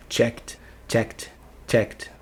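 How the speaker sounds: "checked" as chekt, one syllable. Shown as a demonstration. Teacher drilling the third column, "checked" ending in T. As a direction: end "checked" on a voiceless T after K. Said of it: "faz o som de T de tatu no final".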